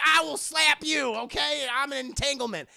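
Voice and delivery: loud, high sassy threat. Retold or imitated. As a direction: snap at the crowd. Jada threatens audience.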